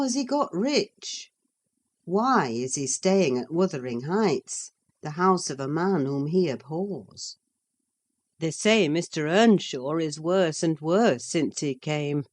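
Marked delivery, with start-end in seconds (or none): none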